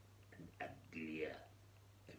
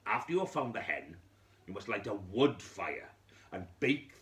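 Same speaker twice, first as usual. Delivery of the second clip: wise man, louder and commanding; wise man instructing; 3 semitones higher, 6 dB louder than usual. Acted. Wise man telling the widow what to do, louder and commanding. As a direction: lay down the strict instructions like an old sage.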